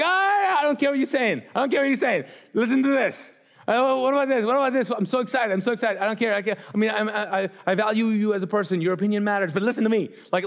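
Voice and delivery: high, breathless gushing joy; overjoyed father. Retold or imitated. Father cuts off returning son, gushing with excitement. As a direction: bubble over like a father who can't contain himself.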